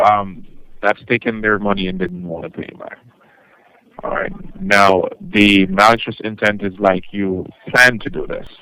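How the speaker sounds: usual speaking voice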